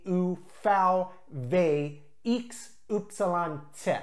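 A, B, C said the German way, ah, bay, tsay; German alphabet, letter by letter in small groups; German letter names. Shown as a demonstration. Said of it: "standard German alphabet is just like English".

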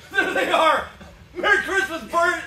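high, loud, bursting with joy; overjoyed. Acted. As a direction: laugh out the relief. George rejoicing, laughing.

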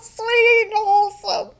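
high-pitched, touched gushing; emotional, near tears. Real, not acted. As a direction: gush as if about to cry happy tears. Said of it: "I'm an emotional mess".

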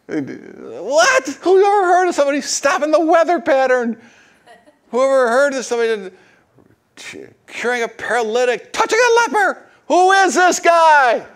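very high, loud astonished squeal; incredulous crowd. Retold or imitated. Crowd marvelling at Jesus' miracles, high and loud. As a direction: shriek it in total disbelief. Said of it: "It's like".

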